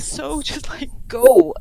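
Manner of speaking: rising to a loud, high cry on go; pleading with him until it breaks out loud; exasperated plea. Retold or imitated. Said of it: "and she's like".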